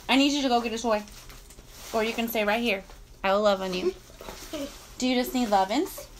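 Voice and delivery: low, soft cooing to a pet; tender pet talk. Real, not acted. Owner offering lovin's to a needy dog, tender. Cuddling her dog off-story.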